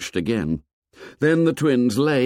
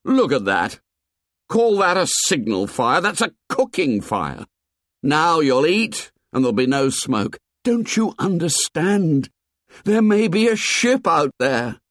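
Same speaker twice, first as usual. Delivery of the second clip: put-on higher, pushed boy's anger; angry pleading; 6 semitones higher than usual. A narrator's reading. Ralph scolds the tribe over the cooking fire, exasperated. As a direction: hammer the point home in frustration.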